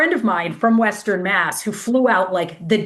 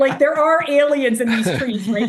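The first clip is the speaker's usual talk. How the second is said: higher-pitched, incredulous questioning; retold disbelief; 5 semitones higher than usual. Runner asks pacer if the aliens are real, incredulous.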